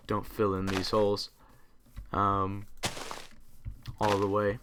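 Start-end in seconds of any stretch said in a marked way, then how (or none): none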